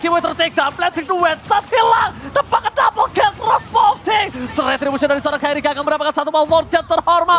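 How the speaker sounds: hyped, high play-by-play call; fighting game announcer. Acted. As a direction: call the teamfight at full hype. Live play-by-play at fight climax. (komentator heboh bernada tinggi)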